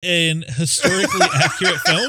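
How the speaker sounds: laughing, high mock-shocked question; teasing mock shock; mock disbelief. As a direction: feign outrage at the history. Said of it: "mock incredulous question".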